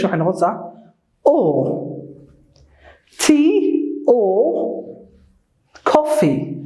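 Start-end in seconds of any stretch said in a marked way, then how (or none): 1.3-1.9 O as a long "aw" with no R; British "or" reduced to a pure vowel O; British silent R. Shown as a demonstration. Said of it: "In the British English". 3.2-6.6 OR said as a long "aw", no R; British OR, just an open vowel; British silent R. Shown as a demonstration. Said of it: "you see the R is silent".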